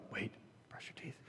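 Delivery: imitated child's hushed, suspicious echo; wary child's question. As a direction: whisper it back like a worried little boy. Wary child echoes the parent.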